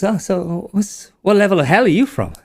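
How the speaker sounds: high, eager wizard voice; curious wizard. Acted. Wizard quizzes the imp about hell, high and eager. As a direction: ask it wide-eyed and high. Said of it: "he goes".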